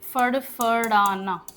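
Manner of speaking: drawn-out फड़फड़ाना, each syllable slow and clear; slow demo word. Shown as a demonstration. Teacher models फड़फड़ाना slowly for the class. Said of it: "Hindi में bat को बोलेंगे".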